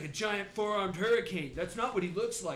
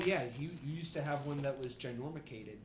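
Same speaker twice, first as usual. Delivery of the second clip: ordinary talk, nothing marked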